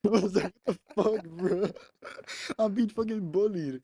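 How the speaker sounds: high, loud protest through laughter; laughing disbelief. Real, not acted. Telling a friend he's being bullied, laughing in disbelief.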